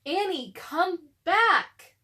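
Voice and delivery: loud, slow, stretched call; Jack calling. A narrator's reading. Brother calling sister off the ladder, loud slow call. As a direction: call her back down firmly.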